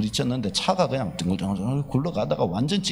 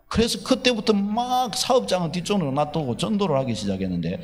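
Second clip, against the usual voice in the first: ordinary delivery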